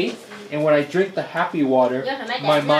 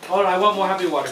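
tipsy; 3 semitones higher, faster than usual. Real, not acted. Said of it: "I'm drunk".

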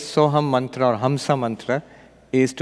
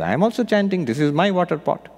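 higher, deadpan claim; innocent sage; 7 semitones higher than usual. Retold or imitated. Sage justifying his own chant, higher mock innocent. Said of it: "sage replies".

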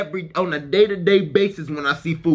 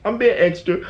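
ordinary talk

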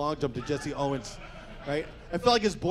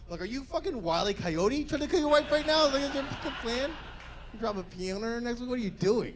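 high, mocking disbelief at the scheme; incredulous rant; 6 semitones higher than usual. Real, not acted. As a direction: rant at him like it's absurd. Ranting at the husband's plan.

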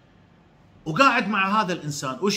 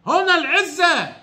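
هنا العزة said higher and slower, stress on هنا; emphatic repeat; 6 semitones higher, slower than usual. Real, not acted. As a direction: lean hard on هنا in هنا العزة.

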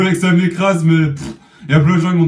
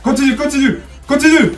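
high, loud hype; excited yelling; 9 semitones higher, 7 dB louder than usual. Real, not acted. Cheering his teammate on, excited high yelling. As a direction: cheer them on at full voice.

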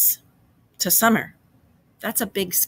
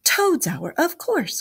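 high, delighted exclamation; 8 semitones higher, slower than usual. A narrator's reading. The mole catches on to the badger's meaning, delightedly. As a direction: pipe up with happy realisation. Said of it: "cried the mole delightedly".

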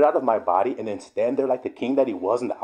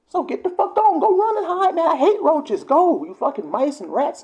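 high, loud tirade at intruders; angry rant; 11 semitones higher, 3 dB louder than usual. Pretend. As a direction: shout them out of the house, easing down gradually. Ranting at roaches to leave.

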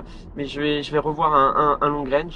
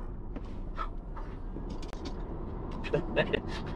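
soft unvoiced laughter; breathy airy giggle; breathless laugh; 13 dB quieter than usual. Real, not acted. Laughing while hard accelerating.

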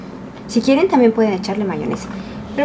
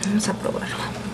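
hushed whisper before tasting; 9 dB quieter than usual. Real, not acted. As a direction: breathe it out as a whisper. About to taste the meat.

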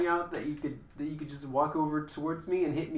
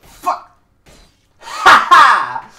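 fight yelling; 12 semitones higher, 23 dB louder, much slower than usual. Real, not acted.

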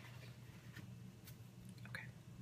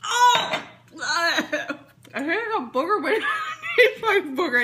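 laughing; 8 semitones higher, 4 dB louder than usual. Real, not acted. Tastes gross jelly bean.

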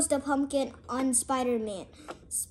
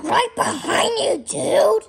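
high, loud character shout; play-acted yell; 10 semitones higher, 9 dB louder than usual. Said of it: "don't have to yell at me".

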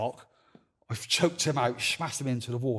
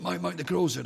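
higher, louder urgent call to Mike; urgent warning; 7 semitones higher, 6 dB louder than usual. Retold or imitated. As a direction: hurry him, she's here. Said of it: "She said".

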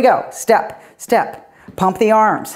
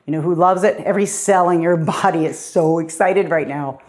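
usual speaking voice

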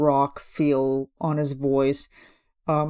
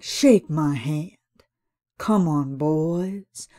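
villain's offer; much slower than usual. Retold or imitated. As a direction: draw the words out like a sly dealer. Imitating Facilier's deal line.

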